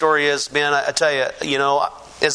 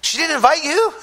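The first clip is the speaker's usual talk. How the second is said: higher, lightly voiced teasing brother; 11 semitones higher than usual. Brother teases him about mom's baptism, higher mock voice. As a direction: needle him like a little brother. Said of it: "He goes".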